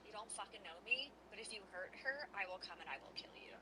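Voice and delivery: hissed, fast, whispered threat; telepathic threat. Acted. Psychic sister warns the centaur in a fast whisper. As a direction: whisper the threat quickly, deadly quiet. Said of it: "In the centaur's head, he's gonna hear".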